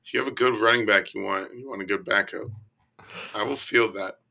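normal talking voice

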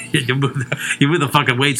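ordinary delivery

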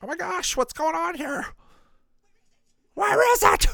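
very high-pitched, put-on alarm; startled character voice. Gamer voicing a startled bystander, high mock panic. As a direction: squeal it out in a high cartoonish panic.

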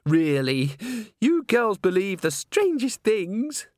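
put-on high, slow, affected girlish voice; patronising diva. A narrator's reading. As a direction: talk down to her, drawing it out. Christine patronises Agnes.

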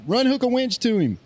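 high-pitched holler without the volume; mild mock yell; retold holler. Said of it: "So I holler at somebody".

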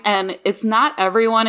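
ordinary talk, nothing marked

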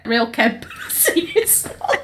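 breaking into laughter mid-sentence; laughing. Real, not acted. Co-host joking about her home county, laughing. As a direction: crack up while finishing the line.

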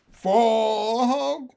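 high, wavering plaintive call; loud, mournful wail of one word; sad voice. Acted. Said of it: "in a sad voice".

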